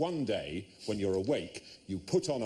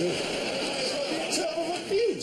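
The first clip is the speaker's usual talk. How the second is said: mock high voice; slower than usual. High slow put-on voice.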